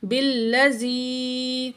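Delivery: slow, drawn-out tajweed chant; Quran recitation. Shown as a demonstration. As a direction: recite slowly with long vowels. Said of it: "با زیر لام". (آہستہ تجوید کے ساتھ تلاوت)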